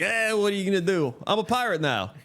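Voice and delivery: lightly mocking, carefree delivery; mock shrug. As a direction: shrug it off as the imagined pirate. Mocking the cook's careless attitude.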